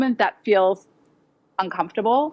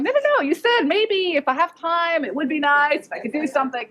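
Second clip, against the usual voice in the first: insisting correction; 7 semitones higher than usual. Retold or imitated. Her insisting reply.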